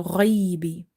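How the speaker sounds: ghaibi from the upper throat; throaty slow ghaibi; heavy letter. Shown as a demonstration. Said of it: "irritation on the upper part".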